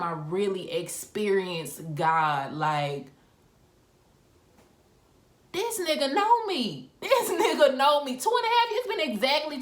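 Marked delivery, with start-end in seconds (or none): 5.5-9.6 high-pitched disbelieving exclamation; retold amazement, pitch leaping up; astonished. Astonished at pastor's accurate prophecy.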